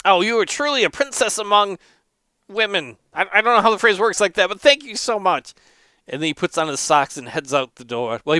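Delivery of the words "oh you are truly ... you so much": raised, gushing character voice; high-pitched effusive thanks; effusive grateful monk. Acted. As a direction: pour on the praise in a higher monk voice. Grateful monk praises the player.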